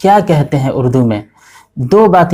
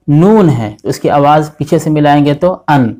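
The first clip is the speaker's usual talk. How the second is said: ordinary talk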